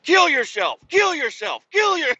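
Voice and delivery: very high, loud mock scream; imitated child screaming. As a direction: mimic a kid yelling wildly at a game. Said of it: "screaming his head off".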